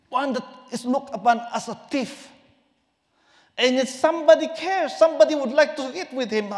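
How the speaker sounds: loud, high calling sermon voice; fervent preaching. Acted. Preacher voicing Jesus calling Zacchaeus, loud and ringing. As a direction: proclaim Jesus's call to come down.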